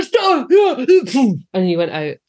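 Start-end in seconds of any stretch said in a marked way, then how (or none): 0.0-0.5 put-on high, loud childlike shout of stop; mock cry of stop. Child under the gas mask shouting at the dentist, mock panicked cry. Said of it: "you go". 0.6-1.5 loud, high imitating gasp as the gas takes hold; mock gasp. Host acting out going under the gas, mock loud gasp.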